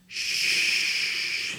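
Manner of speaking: very soft, long hush; faint shush against the ear. A narrator's reading. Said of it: "putting his lips up against Davy's ear".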